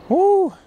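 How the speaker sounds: high, loud "woo"; excited whoop. Real, not acted. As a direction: let out a high celebratory whoop. Angler celebrating a big fish.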